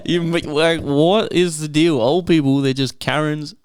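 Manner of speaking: high, loud, exasperated "hello"; exasperated rant. Real, not acted. Ranting about grumpy retirees.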